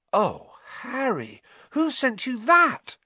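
slow, high, astonished gasp of words; awestruck amazement. Acted. Girl seeing her friend's new broom, amazed. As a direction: draw it out high, rising to loud astonishment. Hermione stares open-mouthed at broom.

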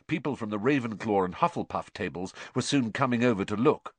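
ordinary delivery, nothing marked

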